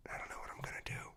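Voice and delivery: fast hushed whisper; a quick secret whisper. Real, not acted. Hushed confession about chapter six.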